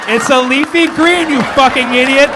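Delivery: mock-scold the crowd member; playful exasperated shout; mock exasperated yell.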